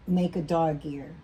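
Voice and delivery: normal talking voice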